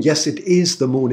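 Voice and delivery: usual speaking voice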